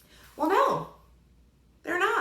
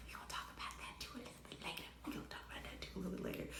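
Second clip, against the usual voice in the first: fast, hushed whisper; whispered aside; 7 semitones lower, 22 dB quieter, much faster than usual. Shown as a demonstration. Reviewer promising viewers more later, whispered aside. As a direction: whisper it quickly like a secret.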